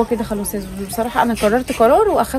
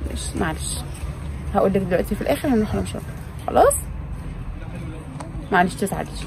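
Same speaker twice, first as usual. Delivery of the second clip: soothing; 5 semitones lower, 7 dB quieter than usual. Acted. Mother soothing crying daughter.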